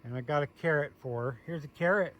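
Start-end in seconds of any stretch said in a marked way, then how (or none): none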